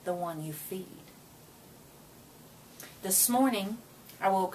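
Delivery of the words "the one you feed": put-on soft, calm old man's answer, a touch quieter; quiet reply. A narrator's reading. Grandfather gently answering grandson's question, quiet and calm. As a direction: let the grandfather answer softly. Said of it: "the old man quietly replied".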